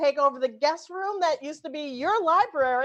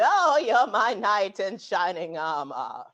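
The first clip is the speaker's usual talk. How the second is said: slow, lower mock Queen's English; Queen's English accent; 6 semitones lower, slower than usual. Shown as a demonstration. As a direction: swoon it out in hammed-up Queen's English. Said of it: "to half Queen's English".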